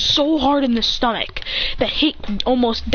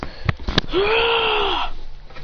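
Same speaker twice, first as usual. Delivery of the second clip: sharp high gasp; a startled fighter's high gasp; 12 semitones higher than usual. Acted. Hero gasps as the Keyblade rises, high gasp. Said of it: "He says".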